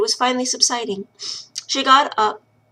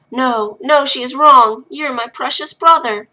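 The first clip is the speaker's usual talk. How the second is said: higher and louder, insisting; fervent reassurance; 4 semitones higher, 6 dB louder, slower than usual. Acted. Lil fervently reassures her little brother, higher and loud. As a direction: reassure him hard.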